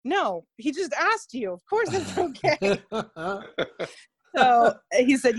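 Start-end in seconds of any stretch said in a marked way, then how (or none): 0.0-2.8 higher, exasperated self-correction; self-mocking scolding. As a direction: tell yourself off in mock exasperation. Mock scolding her own dumb reply.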